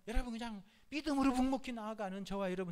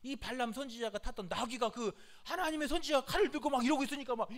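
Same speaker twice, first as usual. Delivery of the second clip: ordinary delivery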